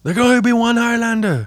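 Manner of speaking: high, dramatic movie-quote voice. Pretend. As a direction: declaim it like the trailer. Quoting the movie line.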